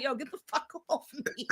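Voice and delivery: hushed cat voice. Retold or imitated. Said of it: "Then the cat is just like".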